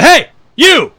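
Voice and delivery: sharp high call across a parking lot; cop yelling. Retold or imitated. Plainclothes cop calling after a man, loud. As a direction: bark it out to stop him. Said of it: "Some plainclothes guy goes".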